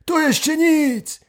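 put-on high old man voice. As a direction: play the second old grandpa. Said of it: "A drugi na to".

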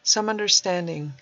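plain reading voice

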